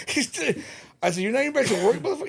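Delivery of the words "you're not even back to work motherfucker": high, loud incredulous protest; retold indignation. Worker retelling his reply to superintendent still at home, indignant. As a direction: act out indignant disbelief, voice shooting up.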